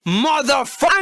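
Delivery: normal talking voice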